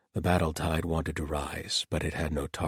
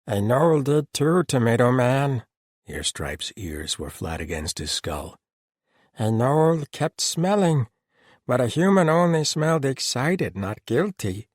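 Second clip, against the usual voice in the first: higher, louder gnoll voice; 8 semitones higher, 8 dB louder than usual. Acted. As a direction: speak as the gnoll. Gnoll character voice.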